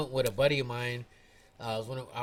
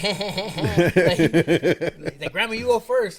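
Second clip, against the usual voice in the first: laughing; 3 semitones higher, 8 dB louder, much slower than usual. Retold or imitated. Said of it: "He's like".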